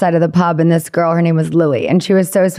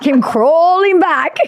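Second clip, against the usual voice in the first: mock dramatic; 9 semitones higher, much slower than usual. Retelling James crawling.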